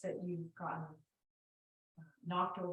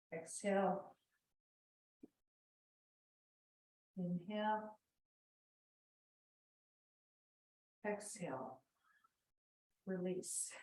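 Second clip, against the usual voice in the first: ocean breath through narrowed throat; soft throaty yoga breath cue; Ujjayi breathing; 5 dB quieter than usual. Shown as a demonstration. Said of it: "my Ujjayi pranayama constricting my throat".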